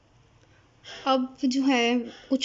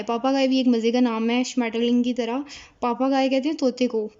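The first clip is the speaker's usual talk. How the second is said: ordinary delivery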